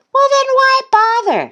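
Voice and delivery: very high, loud duckling scoff; Thistle's dismissive voice. A narrator's reading. Thistle dismissing Goose's games, high scoff. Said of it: "said Thistle".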